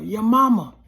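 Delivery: angry street yell; retold yell. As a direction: shout it across the street, daring him. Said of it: "I yell".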